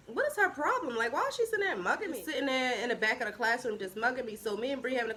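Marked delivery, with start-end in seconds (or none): 0.1-2.2 high, swooping indignant question; puzzled and put out, pitch up. Retold or imitated. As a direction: retell it in high-pitched disbelief. Retold indignation at being stared at.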